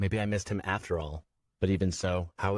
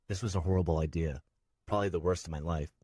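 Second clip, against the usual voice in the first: usual speaking voice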